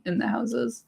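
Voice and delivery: ordinary delivery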